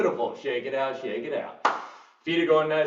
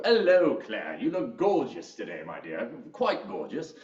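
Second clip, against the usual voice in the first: playful posh British compliment, falling low at phrase ends; hammed-up British charm; British accent; 4 semitones lower than usual. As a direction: flatter them in a put-on British accent. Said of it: "do a little bit of a British accent".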